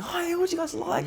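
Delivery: very high, put-on customer-service greeting; mock-cheery order taker; fake smiling service voice. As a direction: greet customers with an exaggerated forced smile. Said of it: "You could always be smiling".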